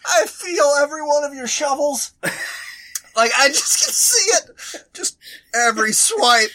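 high, teary laughing voice; laughing so hard it cracks; crying with laughter. Real, not acted. Laughing-crying at shovel story.

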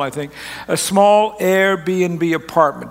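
small slowly and heavily stressed. Shown as a demonstration. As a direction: lean hard on the word small, taking it slowly. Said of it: "I need to emphasize the word small".

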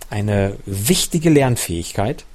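plain reading voice

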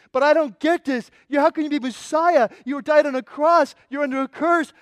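high, loud, acted bewilderment; horrified confusion. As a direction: protest as everything you believed collapses. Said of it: "the confusion".

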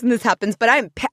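ordinary talk, nothing marked

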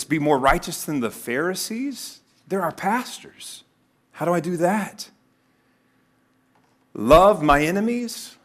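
high, incredulous questioning; acted, rising disbelief; bewildered shock. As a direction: ask as a disciple who can't believe it. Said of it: "probably bewilderment and shock".

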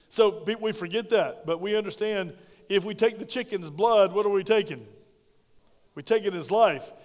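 loud, rising preacher's cry on blood; fiery preaching. Acted. Pastor drives home the blood sacrifice, loud preaching. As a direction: preach it out hard to the church.

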